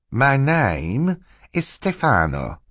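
high, slow, mannered introduction; false Stefano. Acted. Stefano shakes Mister Poe's hand, mannered and slow. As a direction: introduce yourself with put-on grandeur.

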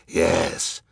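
rasping hoarse judge. A narrator's reading. Third judge agreeing with the other, hoarse. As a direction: scrape the word out hoarsely. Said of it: "said the hoarse one".

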